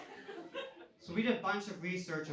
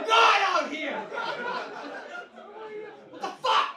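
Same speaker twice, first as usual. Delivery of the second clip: much higher, much louder, laughing outraged shout; outraged yell; 12 semitones higher, 9 dB louder than usual. Retold or imitated. Parent yelling at the old man in the hot tub, outraged shriek. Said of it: "you're like".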